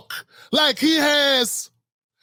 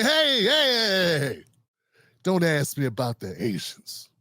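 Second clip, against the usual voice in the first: lightly acted, hushed waving-away of questions; mock politician's brush-off; 5 semitones lower, 4 dB quieter than usual. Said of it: "Does he say".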